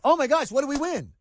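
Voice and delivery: imagined fan, hammed-up joy; mock excitement. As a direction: gush like a fan who thinks we won. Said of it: "what is my first thought".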